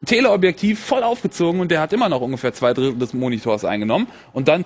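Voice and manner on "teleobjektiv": usual speaking voice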